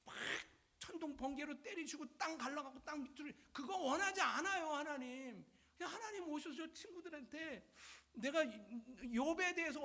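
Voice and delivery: high, exasperated acted pleading; anguished appeal. Job begging God to speak up, anguished complaint. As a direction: plead with bursts of loud, wronged protest. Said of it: "욥의 마음을 가져서".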